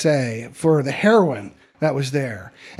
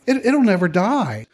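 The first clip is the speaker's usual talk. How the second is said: usual speaking voice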